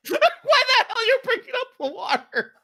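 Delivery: high, loud incredulous laughter; laughing. Real, not acted. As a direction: laugh out the question. Laughing incredulously at water remark.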